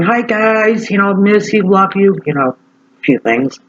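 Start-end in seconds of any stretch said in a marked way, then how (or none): 0.0-2.2 higher, affectionate hello to family; sweet video greeting. Retold or imitated. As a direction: greet the family lovingly on camera. Quoting her own family video.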